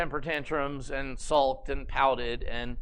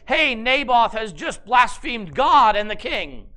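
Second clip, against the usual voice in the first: loud, slow public accusation; accusing shout; 4 semitones higher, 8 dB louder than usual. Acted. False witnesses loudly accuse Naboth before the people. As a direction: denounce him to the crowd.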